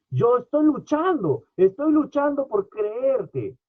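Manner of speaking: father begging Jesus for help, high and slow; struggling father. Retold or imitated. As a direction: wrestle the words out in anguish. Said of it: "qué dijo este hombre".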